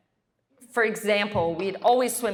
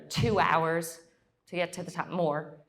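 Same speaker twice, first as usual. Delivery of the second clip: ordinary talk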